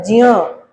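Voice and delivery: ज्य said as half j plus ya, one short syllable; correct gya again. Shown as a demonstration. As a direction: repeat ज्य as the model sound. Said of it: "सही उच्चारण इसका क्या होता है?".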